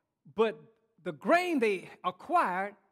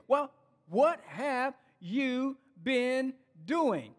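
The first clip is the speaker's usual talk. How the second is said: drawn-out, slow "what have you been doing"; slow pointed question; much slower than usual. Acted. Ants pressing the grasshopper slowly for an answer. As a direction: stretch it, make him answer.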